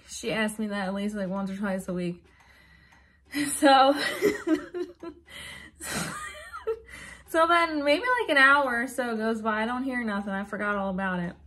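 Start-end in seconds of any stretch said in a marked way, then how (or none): 5.8-7.2 a squeaky, breathless burst of laughter; high helpless laugh. Real, not acted. Telling friends about dad's death, a helpless high laugh. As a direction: laugh it out high and tight, nearly crying.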